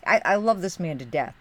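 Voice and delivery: ordinary talk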